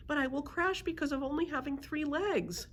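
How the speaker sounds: high-pitched fretful protest; worried griffin. A narrator's reading. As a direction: protest anxiously in a high creature voice.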